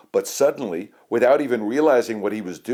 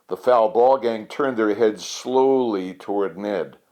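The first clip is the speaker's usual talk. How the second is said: ordinary delivery, nothing marked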